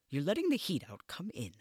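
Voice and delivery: snappy and impatient. A narrator's reading. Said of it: "I heard the impatience in her voice".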